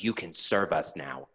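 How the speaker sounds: quiet, slow, curt Kermit; Kermit being curt. Retold or imitated. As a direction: say it flatly, a bit rude. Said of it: "looks at him and goes".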